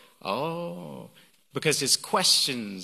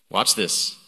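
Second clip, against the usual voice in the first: ordinary talk, nothing marked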